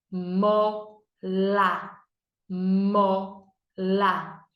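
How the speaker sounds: Mola split into "mo... la"; syllable by syllable. Shown as a demonstration. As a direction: say Mola slowly in two separate beats. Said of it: "Duas sílabas". (Mola em sílabas: "mo... la")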